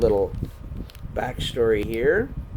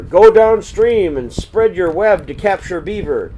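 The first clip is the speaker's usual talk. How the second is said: command; 7 semitones higher, 8 dB louder than usual. Acted. Curlew commands Spider.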